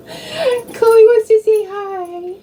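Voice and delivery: high, slow cooing on the dog's behalf. Real, not acted. Speaking for her dog to viewers, high slow cooing. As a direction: coo it slowly for the pet.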